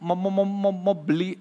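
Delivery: halting stutter, repeating every first sound; a shy stutterer struggles to get the words out; stuttering man. Acted. Nervous stutterer talks to the pastor, stuttering hard. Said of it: "orang gagap ini bilang".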